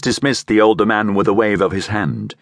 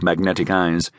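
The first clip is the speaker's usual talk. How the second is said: ordinary delivery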